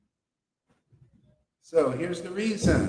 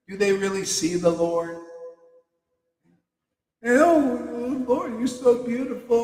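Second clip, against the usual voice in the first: high, gushing mock devotion, rising even higher at the end; mocking gushing worshipper; 8 semitones higher, 3 dB louder than usual. Mocking temple-goers who only see the deity's flowers, high gushing mock rapture. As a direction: ham up a shallow temple-goer swooning over the flowers. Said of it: "And they".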